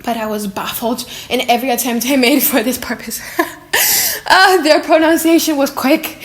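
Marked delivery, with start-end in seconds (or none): 3.4-6.2 laughing. Real, not acted. Reader laughing through the text.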